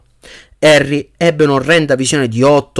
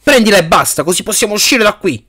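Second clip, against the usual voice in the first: high-pitched exasperated urging; irritated; 8 semitones higher than usual. A narrator's reading. Harry pushes Cedric to take the cup, irritated. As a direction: press him impatiently, pitch raised. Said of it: "disse Harry irritato".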